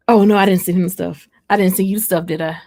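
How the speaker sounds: ordinary delivery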